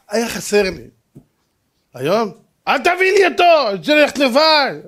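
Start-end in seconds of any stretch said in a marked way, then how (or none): 2.7-4.7 high, insistent protest; imitated sibling complaint; mock petulant child. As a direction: refuse like a fed-up kid. Mimics today's child refusing his brother.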